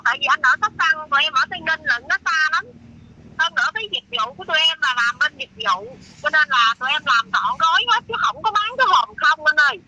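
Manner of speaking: ordinary talk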